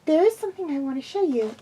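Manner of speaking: high, light filly voice; high childlike question; young pupil's voice. A narrator's reading. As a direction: ask it like a curious little girl. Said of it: "the gremlin said".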